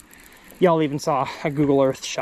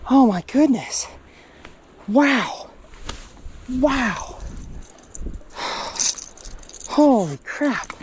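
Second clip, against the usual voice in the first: stunned; 5 semitones higher, slower than usual. Real, not acted. Stunned after the big catch.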